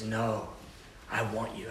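very soft, low, insistent; gently but firmly insist; intimate. Acted. God softly insisting to a hesitant believer.

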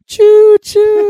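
hooted imitation of a choo-choo ringtone; high, loud train whistle choo-choo. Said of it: "it'd have, like, a choo-choo".

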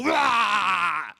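drawn-out "rah" roar; haka war shout. Retold or imitated. Preacher imitating the haka team's closing cry, drawn-out shout. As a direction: roar out the chant's final cry. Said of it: "and then they all go".

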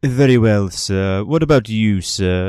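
crisp and attentive; courteous bartender. Acted. Bartender taking the bottle order, crisp and formal.

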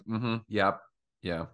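low, fading mock-disinterested murmurs; imitating a checked-out counselor, soft and low; bored, over it. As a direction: humor someone while barely listening. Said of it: "she was over it".

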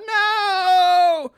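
high, loud retold yell. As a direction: cry out no at the sight of the axe. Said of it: "Deep Throat yells out".

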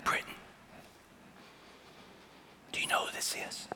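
soft unvoiced whisper into his ear. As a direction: whisper like a secret game. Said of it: "And so I whispered".

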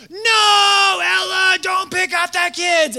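high, loud frantic warning scream; desperate scream. Retold or imitated. Leader warning Ella across the field, frantic scream. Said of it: "'Cause I'm just screaming".

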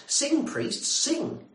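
high, loud call to sing. Retold or imitated. Pilgrims calling the priests, high and loud. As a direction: rouse the priests to song.

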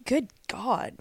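fighting off a sneeze; words held tight against a sneeze; holding back a sneeze. Real, not acted. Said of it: "I'm trying not to sneeze".

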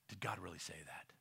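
very low, hushed questioning; near-whispered acted question; whispered doubt. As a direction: plant doubt under your breath. Voices scriptural doubt.

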